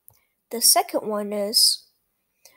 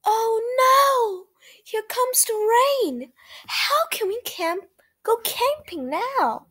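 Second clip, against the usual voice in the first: higher, a touch of worried alarm; mock dismay; 10 semitones higher than usual. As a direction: fret about the rain, lightly. Owner worried about the rain.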